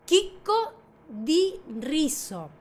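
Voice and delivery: "chicco di riso" slowly, word by word; slow Italian pronunciation. Shown as a demonstration. Teacher drilling "grain of rice", slow "chicco" with k. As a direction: say "chicco" as keek-ko, drawn out. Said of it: "todos los ejemplos sin interrupciones".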